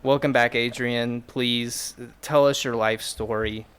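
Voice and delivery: ordinary delivery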